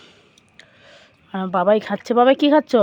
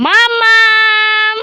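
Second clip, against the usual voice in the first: long high stretched call; drawn-out call; 8 semitones higher, 6 dB louder, much slower than usual. Real, not acted. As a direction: call her from across the yard. Calling daughter from afar.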